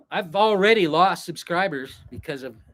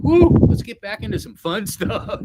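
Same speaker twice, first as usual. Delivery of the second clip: higher and louder, breaking into a laugh; excited outburst; 9 semitones higher, 7 dB louder than usual. Retold or imitated. Vlogger tired of philosophical talk calls for fun, excited laughing. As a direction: burst out wanting some fun, laughing.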